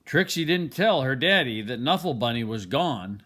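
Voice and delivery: plain reading voice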